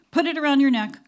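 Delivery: loud, high instruction to an assistant; brisk order. A narrator's reading. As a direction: issue it as a firm order. Crew boss giving orders.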